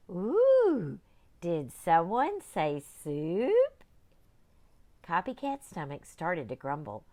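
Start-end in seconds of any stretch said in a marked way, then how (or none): 0.1-3.8 a hungry pirate, drawing it out slowly; eager pirate. Acted.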